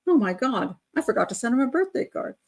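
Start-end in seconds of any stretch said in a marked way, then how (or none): none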